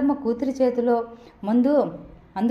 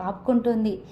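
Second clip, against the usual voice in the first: plain reading voice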